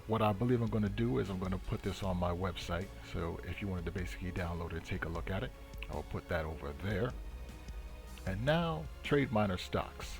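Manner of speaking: normal talking voice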